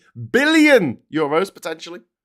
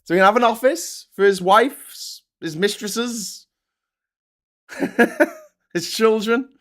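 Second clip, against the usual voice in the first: normal talking voice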